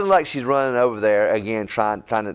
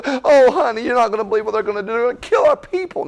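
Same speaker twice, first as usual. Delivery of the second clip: high, fast, panicked blurting; frantic wife; 11 semitones higher, 3 dB louder, faster than usual. Retold or imitated. Esther blurting the plot to the king in a high, panicked rush. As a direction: spill it all in a rush, pitched way up. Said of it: "straight to the point and say".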